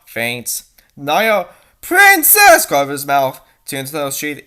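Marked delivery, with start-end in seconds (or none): 1.8-2.7 high, loud startled shout; sudden loud exclamation of recognition; shocked cry. Acted. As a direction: cry the name out in alarm. Character cries out on seeing someone.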